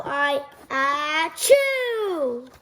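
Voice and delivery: usual speaking voice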